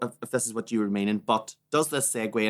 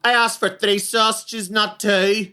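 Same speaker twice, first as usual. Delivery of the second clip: mock angry customer; 9 semitones higher, 6 dB louder than usual. Customer complaining to chip shop staff, mock irate. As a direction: whine about the missing sausage.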